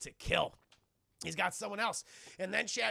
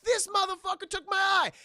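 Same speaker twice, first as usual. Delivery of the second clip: high, loud cry of outrage; outraged mock yell; 12 semitones higher, 8 dB louder than usual. Bo after losing his eye, outraged mock yell. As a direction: yell it in pained disbelief.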